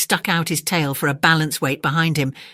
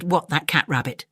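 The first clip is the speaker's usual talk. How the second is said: scoffing dog voice. Acted. Dingo scoffs at Kangaroo.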